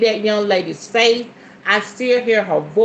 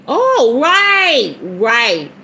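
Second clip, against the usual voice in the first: excited realisation; 4 dB louder, much slower than usual. Real, not acted. Reacting to what she reads, excited outburst. Exclaims on suddenly getting it.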